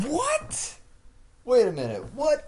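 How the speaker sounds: very high, loud disbelief; shocked. Real, not acted. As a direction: yelp in total disbelief. Sudden shock at broken game.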